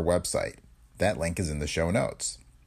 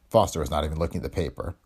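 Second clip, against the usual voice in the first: ordinary delivery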